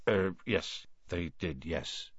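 low, very quiet admission; embarrassed, hesitant undertone; sheepish mumble. A narrator's reading. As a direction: admit it haltingly, voice sinking. Hagrid admits his expulsion, shuffling.

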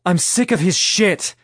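narrator's contained yell. A narrator's reading. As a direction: yell it in fed-up anger, moderately loud. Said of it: "Stella yelled".

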